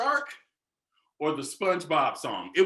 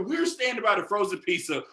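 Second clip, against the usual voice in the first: ordinary talk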